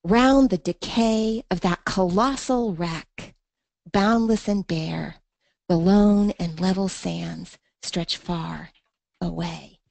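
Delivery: slow, drawn-out reading fading at the end. Shown as a demonstration. Teacher reading the poem's ending slowly to show the turn. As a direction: let the final lines stretch out and fade. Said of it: "You can even hear the break".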